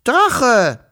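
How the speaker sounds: high, loud call into the distance. Acted. Children call their dragon loudly from the garden. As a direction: shout for the missing dragon. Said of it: "rief Wischel noch einmal".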